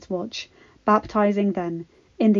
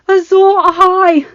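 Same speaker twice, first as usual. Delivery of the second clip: much higher, louder ringing call; proclaiming cry; 11 semitones higher, 11 dB louder than usual. Acted. Red Woman hailing the hero, ringing loud. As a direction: proclaim the prophecy to the field. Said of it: "the Red Woman repeated".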